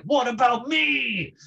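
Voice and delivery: high, slow plaintive whine; mock whiny viewer. Coach imitates a home viewer, pleading in a mock whine. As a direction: playfully imitate a left-out student. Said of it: "you're like".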